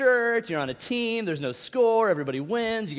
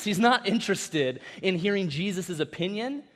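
normal talking voice

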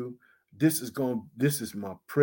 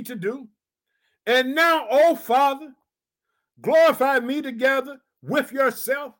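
raised, ringing preacher's voice; preaching; 9 semitones higher, 3 dB louder than usual. Acted. Pastor exhorting his congregation, full preaching voice. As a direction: preach it out to the church.

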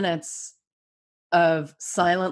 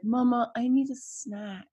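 lightly voiced small boy asking for a snack; a hint of a sleepy toddler; quiet little kid's request; child voice; 6 semitones higher, 4 dB quieter than usual. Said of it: "he was like".

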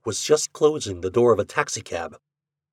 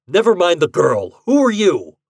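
loud, high impatient demand; command; 8 semitones higher, 8 dB louder than usual. A narrator's reading. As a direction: demand an answer, pitch climbing. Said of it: "he commanded".

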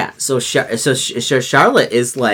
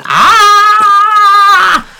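piercing drawn-out yell; cartoon scream as Charlotte yells; long high scream; 10 dB louder than usual. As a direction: let out a long shrill shriek. Scream in the scene.

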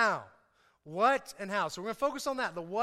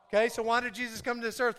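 usual speaking voice

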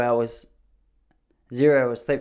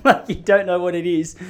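laughing tease; 6 semitones higher, 5 dB louder than usual. Real, not acted. Teasing the audience.

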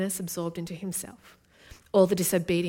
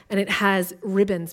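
usual speaking voice

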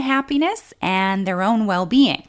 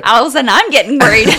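fast, louder joking alarm that dissolves into a laugh; mock worry; 9 dB louder, much faster than usual. Real, not acted. Wife teasing husband about his slip, laughing. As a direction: tease him quickly and break into laughter.

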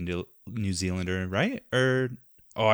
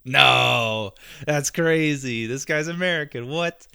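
higher, louder mock disbelief, stretching out that's crazy; stunned disbelief; 5 semitones higher, 4 dB louder, slower than usual. Recalling an interview, he voices his own shock at the actor's accent. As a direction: play up the shock of finding out. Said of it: "I'm like".

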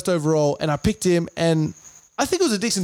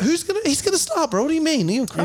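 incredulous; 9 semitones higher, faster than usual. Real, not acted. Arguing about starting front rower.

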